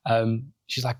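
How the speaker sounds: ordinary delivery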